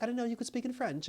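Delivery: high, light imitation of a woman's reply; imitated woman. Woman learns he could speak French, imitated high voice. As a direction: pitch it well up as her quoted remark.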